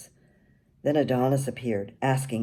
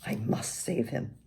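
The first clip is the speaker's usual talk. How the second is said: soft, nearly voiceless inner thought; a hushed thought about rescuing the bartender; 4 semitones lower, 7 dB quieter than usual. A narrator's reading. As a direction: whisper it to yourself like a private resolve. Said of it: "I thought".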